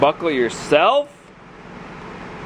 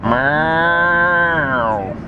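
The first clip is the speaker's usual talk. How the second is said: cow impression; 2 semitones higher, 3 dB louder than usual. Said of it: "about the worst cow impression I've ever done".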